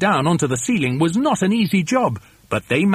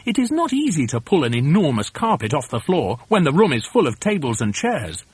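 ordinary delivery, nothing marked

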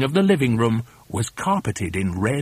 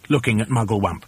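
ordinary delivery

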